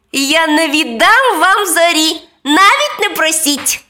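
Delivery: high, loud imp voice; high-pitched impish defiance; defiant little devil. A narrator's reading. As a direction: refuse like a naughty imp. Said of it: "засміявся чортик".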